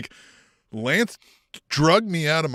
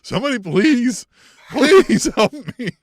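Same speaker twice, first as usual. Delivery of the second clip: high, loud, slow please, laughing; mock desperate plea; 9 semitones higher, 9 dB louder than usual. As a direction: beg like a trapped captive. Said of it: "where Jake's like".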